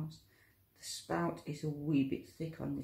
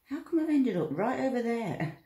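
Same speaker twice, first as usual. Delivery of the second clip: laughing; 6 semitones higher, 7 dB louder than usual. Real, not acted. Laughing at drifting off centre.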